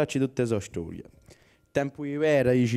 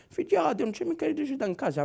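put-on fussy old man; 11 semitones higher, 4 dB quieter, faster than usual. Old man calls about electricity.

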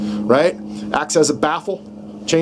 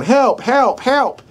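much higher, squeaky rabbit calling for help; rabbit crying for help; 8 semitones higher, much slower than usual. Retold or imitated. Instructor voices a rabbit in distress, high and pleading. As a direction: play a panicked little rabbit. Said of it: "a rabbit going".

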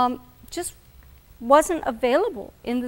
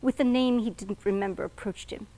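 ordinary delivery

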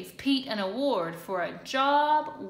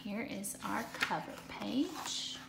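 soft unvoiced whisper to the listeners; 9 dB quieter than usual. Real, not acted. Teacher whispering to kids before the story, hushed whisper.

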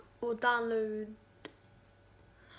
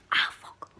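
stubbed toe; 8 dB louder than usual. Real, not acted. Just hit his toe.

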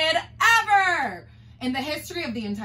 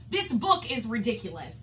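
ordinary delivery, nothing marked

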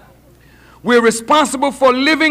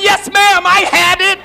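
higher-pitched emphatic answer; insistent daughter; 5 semitones higher, 4 dB louder than usual. Acted. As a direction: insist to your mother. Daughter answering mother's questioning.

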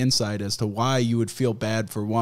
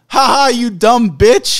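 mocking jeer; 9 semitones higher, 8 dB louder, slower than usual. Mocking commenters quoted.

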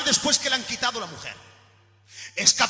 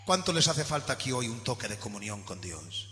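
low, soft closing appeal over music; hushed preacher's plea at the end of the sermon; quiet altar call; 12 semitones lower, 12 dB quieter than usual. Real, not acted. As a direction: invite them gently to weep for their brother.

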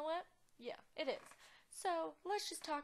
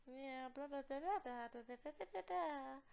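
singsong blah-blah babble; mock chatter; 2 semitones higher than usual. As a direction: imitate idle chit-chat in nonsense syllables. Voicing game characters' chatter.

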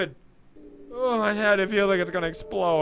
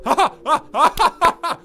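loud high ha ha of triumph; gloating laughter; 9 semitones higher, 8 dB louder than usual. Real, not acted. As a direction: laugh in victory.

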